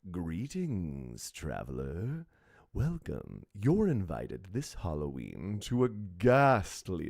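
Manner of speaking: deep, slow haunting narrator; low and slow, like a ghostly host; spooky voiceover. Acted. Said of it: "record voiceover here".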